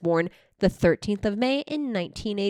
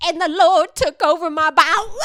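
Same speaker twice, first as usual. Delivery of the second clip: high, loud wailing preacher imitation; shouting preacher; 9 semitones higher, 7 dB louder than usual. Retelling a church clip, shouting preacher. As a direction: wail it out like a preacher overcome. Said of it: "There's a church clip of the guy, he's like".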